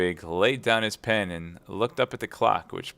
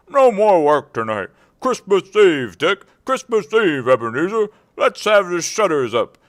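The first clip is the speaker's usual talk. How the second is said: put-on loud, higher hearty old master; jovial Fezziwig; 9 semitones higher, 11 dB louder than usual. A narrator's reading. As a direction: boom out with delight. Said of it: "cried old Fezziwig".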